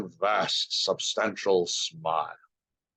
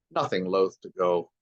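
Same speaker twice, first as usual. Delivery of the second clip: usual speaking voice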